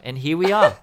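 ordinary talk, nothing marked

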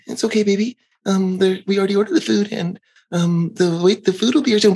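mock placating father voice; soothing dad. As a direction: coax the kid gently, a hint of pleading.

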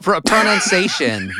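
ordinary talk

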